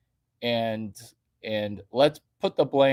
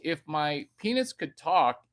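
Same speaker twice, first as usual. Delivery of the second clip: ordinary delivery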